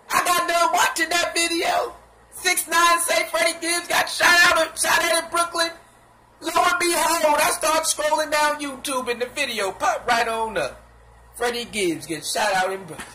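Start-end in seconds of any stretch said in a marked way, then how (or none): 0.1-10.8 excited shouting. Real, not acted. Excited retelling loudly.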